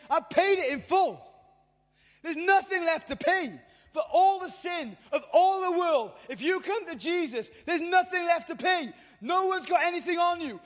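high, loud proclamation of full payment; full-force preacher's cry; preaching at full force. Acted. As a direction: shout it out like the climax of the sermon.

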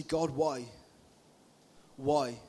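low, soft cry to God; quiet despair. Acted. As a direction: plead low and defeated, with long pauses. Preacher voices a despairing prayer.

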